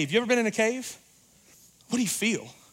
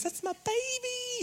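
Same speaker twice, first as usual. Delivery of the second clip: very high, slow, soft cooing; doting parent; 7 dB quieter, slower than usual. Retold or imitated. Parent waving at teen's friends, cooing very high. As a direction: coo it like blowing kisses. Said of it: "blow some kisses".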